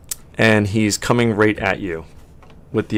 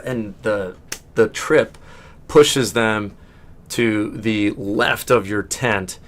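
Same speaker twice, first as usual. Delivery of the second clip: ordinary talk, nothing marked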